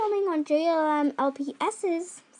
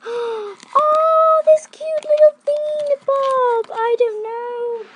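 gasp, then a high, loud squeal; excited squeal; 11 semitones higher, 8 dB louder, slower than usual. Real, not acted. Unboxing toys for viewers, a high excited squeal. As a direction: squeal over a cute toy, higher and louder. Opens blind bag, squeals.

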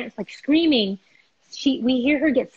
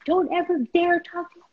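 mock yell pitched high; retold angry scream; 9 semitones higher than usual. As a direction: re-enact telling off a man. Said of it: "I start screaming".

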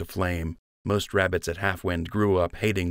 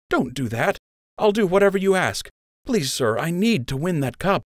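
pleading; 11 semitones higher than usual. A narrator's reading. Joe pleading with officer.

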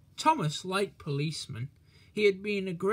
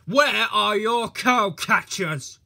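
loud, slow, high accusing demand; 5 semitones higher, 9 dB louder, slower than usual. A narrator's reading. Policeman accuses Thomas of missing parts, loud and accusing. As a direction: point the finger with your voice. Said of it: "he asked accusingly".

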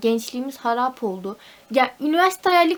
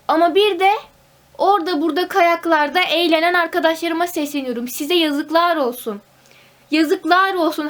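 loud, higher mock-reproachful scolding; 4 semitones higher, 6 dB louder than usual. Real, not acted. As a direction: call out the partying friends dramatically. Scolding friends on ski trips. (arkadaşlarına sitem eden, abartılı azar)

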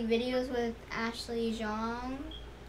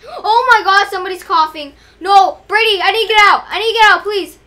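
high, loud panicked begging; frantic, begging to get out; panicked pleading; 6 semitones higher, 16 dB louder than usual. Real, not acted. Panicked locked in room with cougher.

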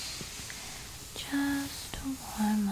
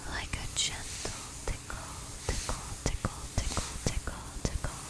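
faint playful whisper; 12 dB quieter, much faster than usual. Acted. Repeated tickle trigger words.

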